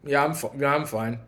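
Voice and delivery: low, soft, drained reply; tired denial. Retold or imitated. Tired guest at wedding, low drained mumble. Exhausted guest insists she's fine.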